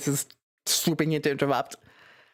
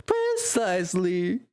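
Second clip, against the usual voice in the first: PRECISELY stretched slowly with a rising end; slow, savoured "Precisely", ending upward; drawn-out delivery; much slower than usual. Acted. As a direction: draw out PRECISELY, lifting the end. Redoes the word drawn out.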